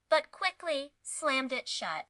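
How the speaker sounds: usual speaking voice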